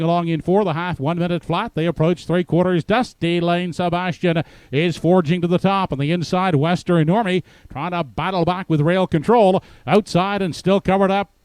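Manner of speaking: building race call, rising high and loud; race caller. Acted. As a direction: drive the stretch call to the wire. Stretch call building to finish.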